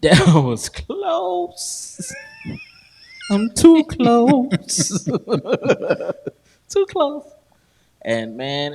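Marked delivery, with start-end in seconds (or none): 0.0-7.2 relieved laughing exclaim. Retold or imitated. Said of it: "'cause I'm like".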